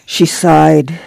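usual speaking voice

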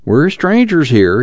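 high, drawn-out ingratiating whine; cringing high-pitched plea; servile whine. A narrator's reading. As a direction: whine it meekly, pitched well up. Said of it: "took on a servile whine".

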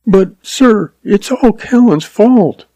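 put-on high-pitched girl's voice, protesting; upset sister defending her brother; pleading young woman. A narrator's reading.

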